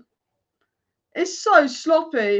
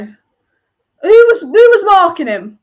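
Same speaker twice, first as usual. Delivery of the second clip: outraged loud question; 2 semitones higher, 11 dB louder, slower than usual. Real, not acted. Fan outraged at the defending.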